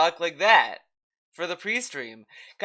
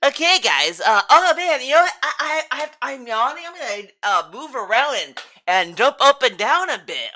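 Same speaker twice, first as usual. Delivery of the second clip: mocking impression; 6 semitones higher, 4 dB louder than usual. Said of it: "was like".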